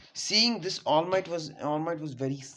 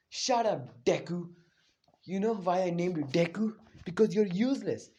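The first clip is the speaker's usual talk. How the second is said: higher, louder taunting yell; bully's angry shout; 3 semitones higher, 5 dB louder than usual. Retold or imitated. Bakugo yelling at Deku after the explosion, loud taunt. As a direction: snarl it down at him. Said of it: "he's like".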